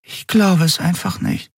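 high, dumbfounded voice; aghast. A narrator's reading. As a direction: say it in stunned disbelief. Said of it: "Dann sagte sie entgeistert".

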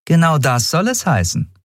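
lightly voiced captain, high and kind; smiling captain. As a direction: tell him yes with a smile. (lächelnde Kapitänin)

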